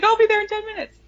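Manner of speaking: high, breezy cartoonish reply; cheery Aberforth. Aberforth answering Percy, high and breezy. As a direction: chirp it back carelessly. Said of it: "He's like".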